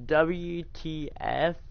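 very slow, stretched letters; slow drawn-out. Retold or imitated. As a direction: drag out each letter slowly. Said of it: "It was like".

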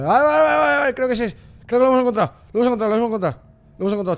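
high, loud burst of relief; excited yells settling slightly; elated shouting, easing. Real, not acted. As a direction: yell it out, thrilled to have found it. Finds the place after panic.

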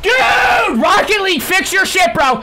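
loud, high angry rant; raging at the game mid-match; furious yelling. Real, not acted. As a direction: scream at the developers. Ranting at the game.

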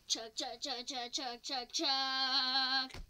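steady chugs, last one loud and drawn out; imitated steam train; train chugging. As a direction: chug along like a train, building at the end. Imitating a train between stops.

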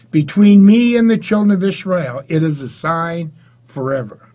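loud, solemn Bible reading; scripture reading. Acted. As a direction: proclaim the verses to the congregation. Preacher reads scripture aloud.

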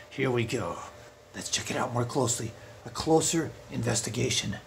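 soft, low sleuthing murmur; hushed investigation. Acted. Burglar inspecting the painting closely, hushed investigation. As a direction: drop to a confidential undertone.

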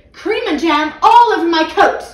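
loud, high, bitter complaint; bitter outburst. A narrator's reading. Taxi driver loudly fuming about his sticky coat. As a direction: spit out the grievance, loud and sour. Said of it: "he said bitterly".

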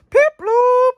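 high loud squeal of the name; excited squeal. Real, not acted. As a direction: squeal it with joy.

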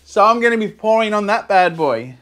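usual speaking voice

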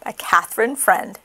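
usual speaking voice

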